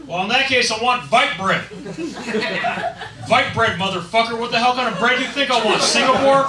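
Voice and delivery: loud, high rant with V for W; hammed-up belligerent accented bread rant; proud ranting customer. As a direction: shout it with outraged pride. Customer rants in patriot's manner.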